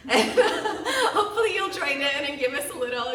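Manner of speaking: high, loud, laughing all through; talking over your own laugh. Real, not acted. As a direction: invite them in through real laughter. Laughs inviting remote audience to clap.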